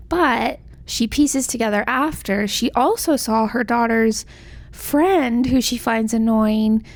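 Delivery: ordinary talk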